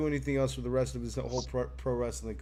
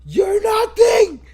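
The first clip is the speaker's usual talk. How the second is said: bellowed high and loud, full force; furious shout; 14 dB louder than usual. Retold or imitated. Podcaster imitating Gunther yelling at Jey Uso, furious shout. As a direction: scream it down at a beaten opponent. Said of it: "he just fucking yells".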